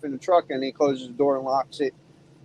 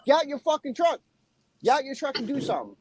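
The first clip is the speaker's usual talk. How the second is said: retold dare; 8 semitones higher than usual. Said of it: "I was like".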